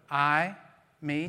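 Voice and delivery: normal talking voice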